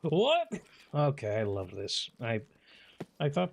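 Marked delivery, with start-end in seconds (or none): 0.0-0.5 high rising surprised What; mock surprised echo. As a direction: squeak it back in mock shock. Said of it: "mocks the in-game line".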